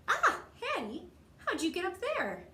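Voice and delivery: put-on amused surprise at the kid; startled laugh. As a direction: caught off guard, laughing. Startled by Hattie up high.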